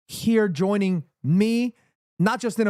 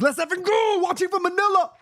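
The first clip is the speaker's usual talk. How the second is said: high, hollered fan cheer; excited cheer; 10 semitones higher than usual. Acted. Host reads a Manila viewer's message, high excited shout. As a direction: shout it out like a pumped-up supporter.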